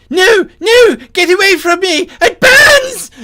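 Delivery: very high, loud screaming; terrified cry. Acted. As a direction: shriek in agony, fending off the burning. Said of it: "his cry suddenly change".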